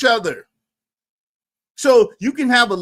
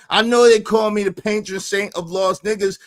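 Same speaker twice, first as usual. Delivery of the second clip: usual speaking voice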